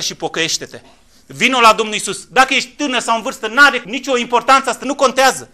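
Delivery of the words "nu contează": normal talking voice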